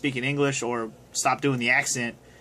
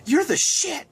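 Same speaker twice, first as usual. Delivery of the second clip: much higher, awed nerdy fanboy squeal; imitating Otacon; 11 semitones higher, slower than usual. Fan quotes Otacon's favorite line about Snake, high awed squeal. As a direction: gush at Snake like a starstruck sidekick. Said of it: "He's like".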